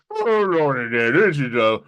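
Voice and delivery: exaggerated Southern rocking-chair voice; full-on crazy Southern. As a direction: ham up a crazy Southern dad, pitch swooping high. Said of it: "full-on crazy Southern. So I just went".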